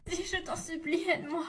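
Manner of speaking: high, frightened cry for help; terrified pleading. Acted. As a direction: beg for mercy in panic. Victim begs for help.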